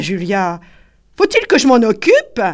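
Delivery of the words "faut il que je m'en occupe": higher, louder eager question; woman's voice. A narrator's reading. Julia asking the men, high loud question. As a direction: ask it brightly.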